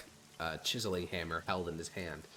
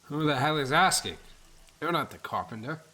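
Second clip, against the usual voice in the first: put-on loud, raised drunken sneer; drunk thug voice; 8 semitones higher, 10 dB louder than usual. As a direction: challenge the intruder aggressively. Drunk Harris confronts stranger.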